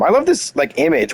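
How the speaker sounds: fangirling over the art; gushing. Real, not acted. Gushing over the character image.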